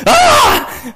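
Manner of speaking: sudden loud high-pitched "ah"; high joke shriek; mock scream. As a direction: let out a short, high comic yell. Jokes about a dentist's love.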